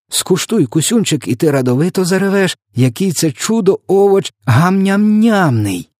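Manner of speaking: high-pitched, gushing sales pitch; fervent assurance. A narrator's reading. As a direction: rave about it, stretching the final words. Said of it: "гаряче запевнив".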